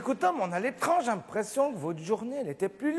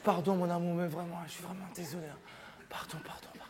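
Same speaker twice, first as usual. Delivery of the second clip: the soft make-up voice, fading to a near whisper; 2 semitones lower, 7 dB quieter, faster than usual. Shown as a demonstration. S'excusant auprès de son amoureuse, voix douce qui finit en chuchotement. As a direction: coax her back, all tenderness, dropping to a whisper. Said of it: "J'ai utilisé la voix douce".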